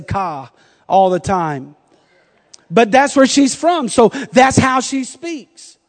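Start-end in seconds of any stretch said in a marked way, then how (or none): 0.0-0.5 imitated Boston "car" with no r; soft Boston r-less car; Boston accent. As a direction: mimic a Boston aunt saying car. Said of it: "I get her to say".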